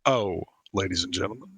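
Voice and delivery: lightly voiced pilot over the intercom; airline pilot voice. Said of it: "sound like a Delta pilot".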